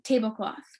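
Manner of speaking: plain reading voice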